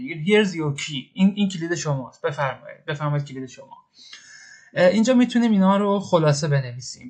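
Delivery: ordinary talk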